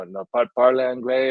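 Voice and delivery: put-on clumsy French Canadian accented French; halting schoolbook French with a French Canadian accent. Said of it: "I've got the French Canadian accent".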